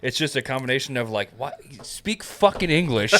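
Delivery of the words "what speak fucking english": mock exasperation. Mocking reaction to English accents.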